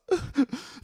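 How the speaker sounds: high, short crying gasps; mock sobs. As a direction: sob like a tearful child, hammed up. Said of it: "through tears".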